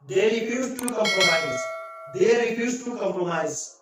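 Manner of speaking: very slow, word by word; slow teacher dictation of "You give me a compliment". Shown as a demonstration. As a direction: spell the sentence out for students. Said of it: "teacher dictates example sentence".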